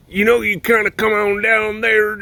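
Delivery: high, loud put-on Southern accent; deep South Alabama accent. Likening Australia to Alabama, exaggerated Southern accent. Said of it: "Australia is kinda like deep South Alabama".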